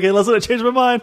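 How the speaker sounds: loud, high and laughing; mock man recalling bear. Man calls the bear back to eat her, laughing shout. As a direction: call out with a laugh. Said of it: "You're like".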